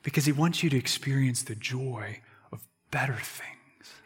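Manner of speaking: soft whisper, leaning in close; whispered appeal. Real, not acted. Preacher gently whispering to his friends about joy. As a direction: breathe it to them like a secret.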